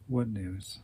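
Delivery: low, soft near-whisper. Acted. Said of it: "She whispered".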